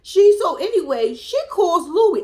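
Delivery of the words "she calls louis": ordinary talk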